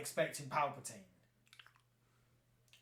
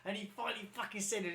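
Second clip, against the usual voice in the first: much higher excited outburst; celebrating; 7 semitones higher than usual. Retold or imitated. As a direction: cheer it like the wait is finally over. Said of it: "I was celebrating".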